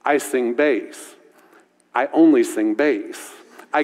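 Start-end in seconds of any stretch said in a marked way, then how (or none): none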